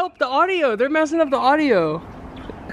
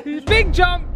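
usual speaking voice

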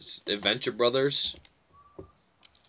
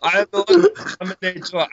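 high, loud hammed-up Terminator; 4 dB louder than usual. Friend hamming up a Terminator line mid-game. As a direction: boom it out like a movie robot. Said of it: "to be Terminator again".

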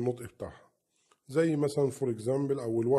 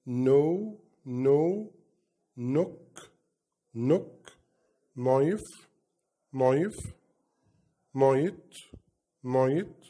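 silent K words, each said twice, louder; teacher drill; 3 dB louder, much slower than usual. Shown as a demonstration. Teacher drilling silent K words for kids, louder. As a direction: model the words with no K sound. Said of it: "هننطقها مع بعض".

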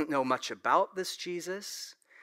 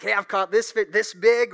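bragging fisherman; 5 semitones higher, 9 dB louder than usual. Retold or imitated. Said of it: "Usually it's like".

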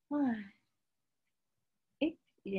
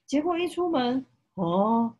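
loud, high storybook gasp; surprised; 5 semitones higher, 9 dB louder than usual. A narrator's reading. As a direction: make the twist a big surprise. Surprise at sunny day.

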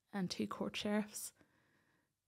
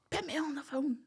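higher, gruff imitation of a Northern Irish farmer dad; blunt dairy-man dad; 6 semitones higher than usual. Daddy taking the phone from the sheriffs, blunt imitation. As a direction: play the no-nonsense dad taking over the call. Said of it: "typical Northern Ireland dairy man".

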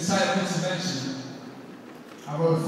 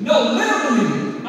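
louder, punch literally; sudden louder outburst; 8 semitones higher, 10 dB louder than usual. Acted. Son correcting himself to his mom, louder. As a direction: snap from figurative to real.

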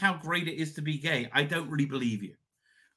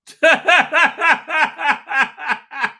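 loud, higher burst of laughter; delighted laugh; 11 semitones higher, 9 dB louder than usual. Real, not acted. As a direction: laugh out with happy nostalgia. Laughs at old chippy memory.

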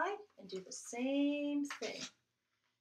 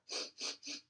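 quick sniffs in the air; sniffing; 5 dB quieter than usual. Acted. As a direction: sniff like a bear catching a scent. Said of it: "Whiff, whiff, whiff".

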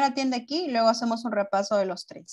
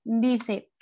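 ordinary talk

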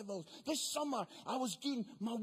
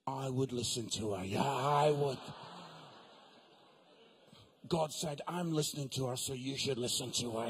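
much lower, calm God voice; 10 semitones lower than usual. Retold or imitated. God advises Mark from above, deep and calm. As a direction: boom it low like God advising.

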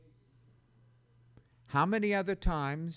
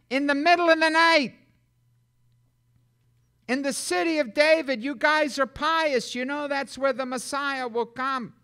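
high, loud, ringing proclamation; fiery pulpit preaching; preaching at full force; 10 semitones higher, 9 dB louder than usual. Acted. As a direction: proclaim it to the whole congregation. Preaching the angel's announcement.

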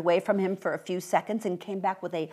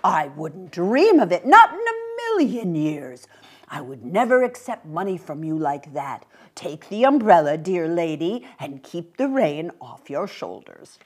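raised, proper lady's voice, firm refusal; genteel mother; 7 dB louder than usual. Acted. Mother insisting to the little man, proper and firm. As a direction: play the mother graciously insisting.

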